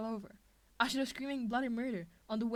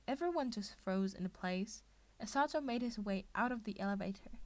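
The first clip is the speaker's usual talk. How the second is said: ordinary delivery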